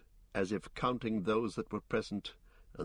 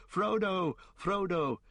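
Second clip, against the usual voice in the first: calling out; 10 semitones higher, slower than usual. A narrator's reading. Hobbits searching the woods for Frodo, high calling. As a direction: call a lost friend in a high hobbit voice.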